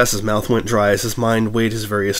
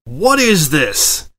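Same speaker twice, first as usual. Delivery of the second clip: slow, louder, pitched-up demand; stormy demand; 6 semitones higher, 5 dB louder, much slower than usual. A narrator's reading. Editor confronts her reporter, angry and slow. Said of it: "Etta stormed onward".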